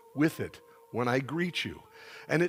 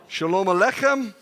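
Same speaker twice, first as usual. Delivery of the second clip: loud, higher projected greeting; call-and-response lead; 5 semitones higher, 7 dB louder than usual. Shown as a demonstration. Preacher prompting congregation to respond, loud projected call. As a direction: call it out for the whole room.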